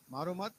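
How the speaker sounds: "मारो मत" run together, rising on "मत"; no break before मत. Shown as a demonstration. Preacher asks where the full stop goes, "मारो मत" joined. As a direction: keep "मारो मत" as one phrase, lifting at "मत". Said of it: "कहाँ लगाएंगे बिंदी".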